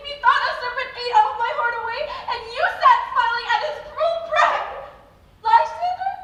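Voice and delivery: high, very loud panicked cries for help; terrified screaming. Acted. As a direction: scream for Lysander as if the snake is still on you. Hermia wakes from a nightmare.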